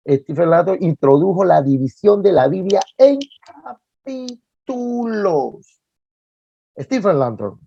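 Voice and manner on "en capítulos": CAPÍTULOS stretched out syllable by syllable, higher; énfasis didáctico. Real, not acted. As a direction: draw out CAPÍTULOS slowly, each syllable separate. Teacher spells out key term slowly. (énfasis didáctico, sílaba por sílaba en CAPÍTULOS)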